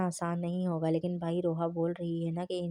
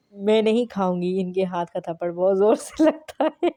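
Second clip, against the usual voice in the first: scared blurt; 9 dB louder than usual. Acted. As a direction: blurt it out in fright about the slap. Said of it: "ہانم ڈر کے بے ساختہ بولی".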